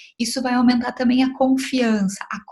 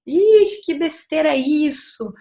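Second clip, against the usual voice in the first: louder, higher mock scoff on Ixi; scoffing parent; 4 semitones higher, 3 dB louder than usual. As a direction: belittle the child's anger. Pai desdenha da raiva da criança. (imitação de mãe desdenhosa, com um Ixi agudo e alto)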